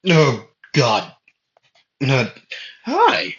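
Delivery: sudden high squeak on hi; flustered hi; awkward greeting. Acted. Asta stands up dazed and greets the Bulls, squeaky.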